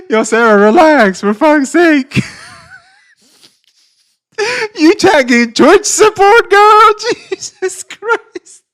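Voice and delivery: very high, squealing through laughter; helpless laughing protest. Real, not acted. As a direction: plead in disbelief while cracking up. Cracking up at Sarah's post.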